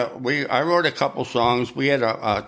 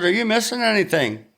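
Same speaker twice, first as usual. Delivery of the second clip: sly teasing question; 9 semitones higher than usual. Retold or imitated. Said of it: "I said".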